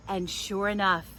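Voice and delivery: ordinary delivery, nothing marked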